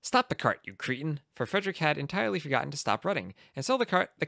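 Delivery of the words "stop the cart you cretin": ordinary delivery, nothing marked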